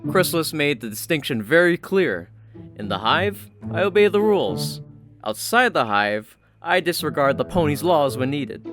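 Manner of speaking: ordinary delivery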